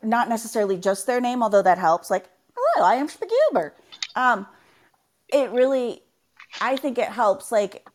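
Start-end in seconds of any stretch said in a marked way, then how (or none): 2.5-3.7 high-pitched, goofy gnome catchphrase; cheerfully dim character voice in a made-up accent; Spagoober. Acted. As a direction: slip into a silly high voice. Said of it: "say in that character's accent".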